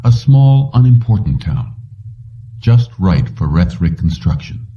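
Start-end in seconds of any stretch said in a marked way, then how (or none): none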